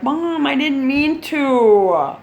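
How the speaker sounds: high, drawn-out mock whine; imitated whining boys. Boys plead with mom, mock whining. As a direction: whine slowly like a pleading kid.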